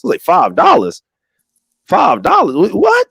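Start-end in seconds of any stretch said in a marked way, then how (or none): 1.9-2.6 incredulous. Retold or imitated. Incredulous retelling of loan request. 2.7-3.1 squeaky high disbelief; high-pitched stunned what; shocked what. Retold or imitated. Disbelief at five dollar request.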